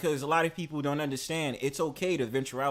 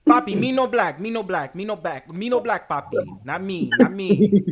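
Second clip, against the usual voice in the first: high, loud hammed-up Spanish protest; mock Spanish accent; 5 semitones higher, 8 dB louder than usual. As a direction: insist loudly in a fake Spanish accent. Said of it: "I was like".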